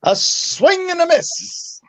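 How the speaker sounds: mock sportscaster, high and slow; announcer call.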